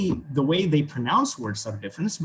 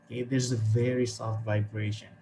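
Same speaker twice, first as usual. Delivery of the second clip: normal talking voice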